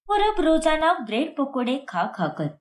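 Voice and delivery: ordinary delivery, nothing marked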